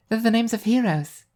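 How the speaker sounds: put-on high, sure girl's voice; confident Alice. A narrator's reading. Alice telling her friend about the graffiti, high and confident. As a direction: state it proudly like a little sister. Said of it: "she says confidently".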